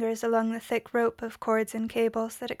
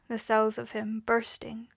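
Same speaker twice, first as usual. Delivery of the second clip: usual speaking voice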